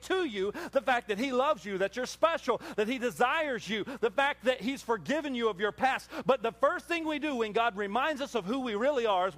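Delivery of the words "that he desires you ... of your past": usual speaking voice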